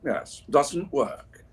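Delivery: slow, weighty wizard pronouncement of doesn't work; Gandalf impression. Slow Gandalf voice explaining air conditioning to chat. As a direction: draw it out like a solemn old wizard. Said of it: "as Gandalf would say".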